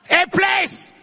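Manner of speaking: high, loud one-word proclamation; ringing high call; emphatic shout. Real, not acted. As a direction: cry it out to the whole congregation. Calls out answer to congregation.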